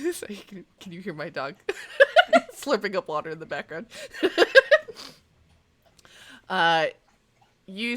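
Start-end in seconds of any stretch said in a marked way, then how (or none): 1.6-2.5 sudden high burst of laughter; real laugh. Real, not acted. Laughing at dog slurping water. 3.9-5.1 sudden high burst of laughter; real laugh. Real, not acted. Laughing at dog slurping water.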